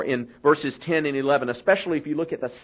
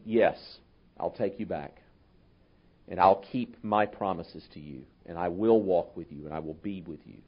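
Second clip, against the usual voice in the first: tender reassurance; 9 semitones lower, 6 dB quieter than usual. Retold or imitated. God voiced taking Israel back.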